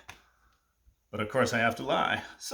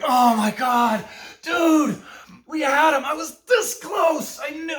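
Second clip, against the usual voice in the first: much higher, louder exasperated yell; frustrated outburst; 6 dB louder, slower than usual. Retold or imitated. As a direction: blow up at your friend for ruining it. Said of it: "out of my mind. So frustrated".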